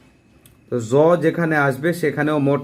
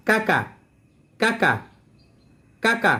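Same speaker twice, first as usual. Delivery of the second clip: quick, higher কাকা snapped out fast; very fast drill; 5 semitones higher, 4 dB quieter than usual. Shown as a demonstration. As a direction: rattle the syllables off at speed. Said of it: "খুব স্পিডে". (খুব দ্রুত, উঁচু স্বরে অনুশীলন)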